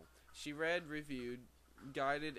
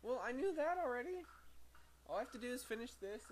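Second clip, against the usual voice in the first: irritated retort; 11 semitones higher, faster than usual. Real, not acted. Bickering with the person who walked in.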